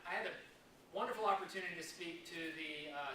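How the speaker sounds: ordinary talk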